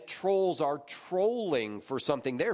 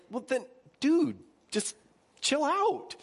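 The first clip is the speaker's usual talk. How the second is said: half-mock telling a friend to relax; high exasperated chill out; 12 semitones higher than usual. Exasperated retelling of argument.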